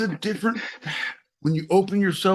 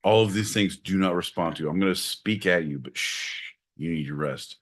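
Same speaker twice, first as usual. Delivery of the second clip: mock soothing; 13 semitones lower, 4 dB quieter than usual. Soothing partner, shh.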